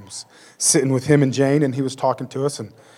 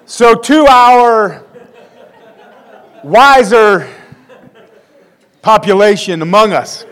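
slow, louder, higher careful phrasing; drawn-out tactful wording; 5 semitones higher, 6 dB louder, slower than usual. Real, not acted. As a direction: stretch the words to smooth the gaffe. Tactful correction after gaffe.

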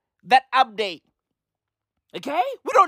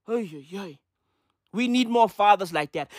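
ordinary talk

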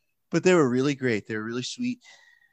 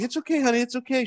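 soothing parent; 12 semitones higher, faster than usual. Retold or imitated. Parent soothing crying child.

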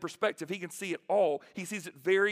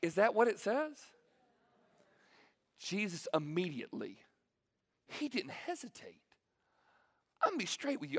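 ordinary talk, nothing marked

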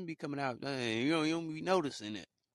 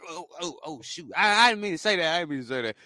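flustered, suddenly louder denial; backpedaling demon; 4 semitones higher, 7 dB louder, faster than usual. Retold or imitated. Demon caught out, hastily taking back its words. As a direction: caught out, scramble to take it back. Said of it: "it's like".

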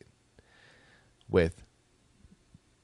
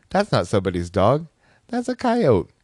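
louder, with a high squeak of surprise on coyote; surprised; 4 semitones higher, 4 dB louder than usual. Retold or imitated. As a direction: gasp out the realization. Realizing it's a coyote.

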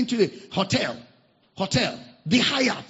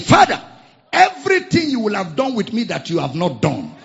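put-on loud fervent old man's prayer; 2 semitones higher, 10 dB louder than usual. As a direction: call out to God over him. Old man praying in story.

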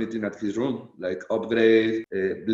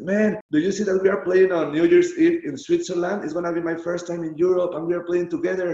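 higher-pitched, eager mimicry of a friend; Mandragora impression; 5 semitones higher than usual. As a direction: imitate a friend announcing big news, pitched up. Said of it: "You mimic him really well".

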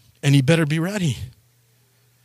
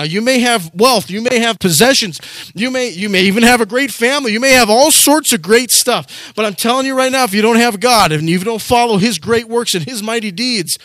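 higher, louder preaching warning; fervent preaching; 6 semitones higher, 4 dB louder than usual. Acted. Pastor warning the comfortable and wealthy, louder higher preaching. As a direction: preach it hard and urgent.

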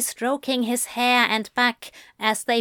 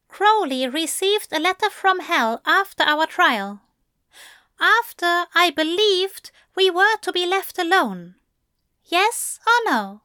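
higher, firm angel voice; demanding angel; 9 semitones higher, 4 dB louder than usual. A narrator's reading. Angel demands the truth from the demon, firm and higher. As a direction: press for a straight answer. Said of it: "Aziraphale demanded".

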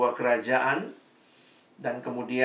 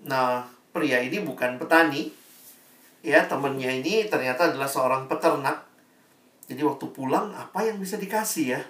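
usual speaking voice

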